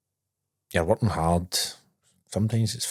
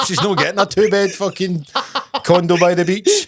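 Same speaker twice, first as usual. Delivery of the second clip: laughing retort; 9 semitones higher, 5 dB louder than usual. Retold or imitated. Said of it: "I said".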